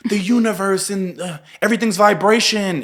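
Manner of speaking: airy high new-age voice; spiritual person voice. Retold or imitated. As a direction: mimic a dreamy spiritual type. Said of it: "there's people who are like".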